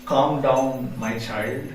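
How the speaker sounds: low, soft divine voice; deep grave voice. Acted. Narayan calming Gopakumar, deep grave voice. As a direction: soothe slowly in a deep, hushed tone. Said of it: "very deep, grey voice".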